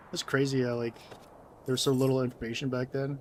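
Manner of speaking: usual speaking voice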